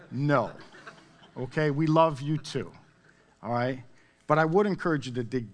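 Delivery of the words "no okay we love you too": ordinary talk, nothing marked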